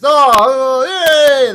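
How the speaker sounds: a wobbly groan turning to a cheer; crowd cheer. Retold or imitated. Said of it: "And the crowd goes".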